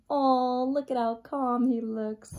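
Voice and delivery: plain reading voice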